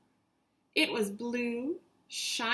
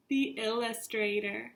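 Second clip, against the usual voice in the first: ordinary delivery, nothing marked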